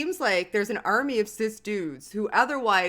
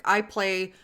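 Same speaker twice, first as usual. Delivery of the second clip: normal talking voice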